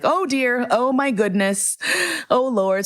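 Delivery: choked-up high flustered exclaiming; overwhelmed by tears at a compliment; tearful. Real, not acted. To the photographer, teary and flustered, overcome with emotion. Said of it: "And I started crying".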